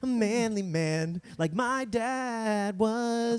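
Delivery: high, slow, hammed-up heroic kid voice; mock manly boy. Mocking young Roosevelt's manly dreams, high and slow. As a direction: puff up like a boy playing hero.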